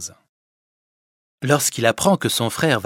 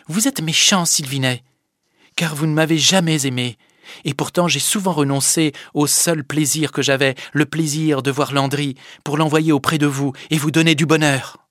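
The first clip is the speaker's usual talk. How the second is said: higher, louder harsh reproach; scolding Fadette; 5 semitones higher, 7 dB louder than usual. Acted. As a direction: scold him without pity. Fadette lui parle durement. (la fadette qui gronde, dure)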